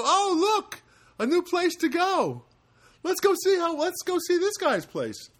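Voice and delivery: high, excited little fly voice; squeaky eager bug delight; voiced flies. Podcaster voicing flies finding his open window, high and giddy.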